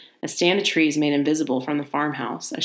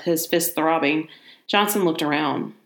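ordinary delivery, nothing marked

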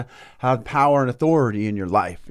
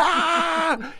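frustrated cry; 3 dB quieter than usual. Retold or imitated. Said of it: "he's going".

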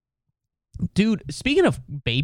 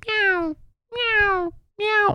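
high, whiny imitated cat meows; much slower than usual. Imitating his cat as torture for secrets, high mock meows. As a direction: mimic a nagging cat, very high. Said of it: "my cat just going".

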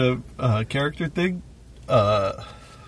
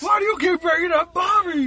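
put-on much higher, slowed, shouting old-man voice; snapping old man. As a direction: snap at her in exasperation. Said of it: "snapped at the old lady".

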